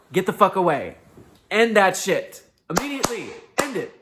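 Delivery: loud, high, forceful command; emphatic shout. Real, not acted. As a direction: bark it out like a blunt order. Blunt advice to cut people off.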